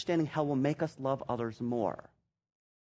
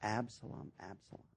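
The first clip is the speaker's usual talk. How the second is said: much softer, lower, fading into grief; grieving lament; 6 semitones lower, 16 dB quieter than usual. Retold or imitated. David mourning his son Absalom, softly grieving. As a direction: let it sink away like a broken father. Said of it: "David crying over Absalom".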